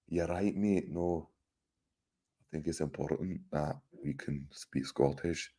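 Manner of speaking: soft, low mock Scottish accent, quiet. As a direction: put on a half-hearted Scottish accent, softly. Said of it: "A Scottish accent and a German accent".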